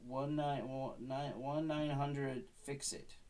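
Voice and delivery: slow, spelled-out digits; slow number. Acted. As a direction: read it out digit by digit. Reading phone number.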